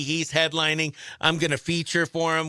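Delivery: ordinary talk, nothing marked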